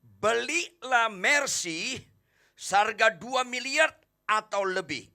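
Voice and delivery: drawn-out high advertisement read; slow ad announcer. Acted. Preacher mimics a car ad to the congregation, slow and high. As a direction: stretch each word out like a TV car ad. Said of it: "ada iklan".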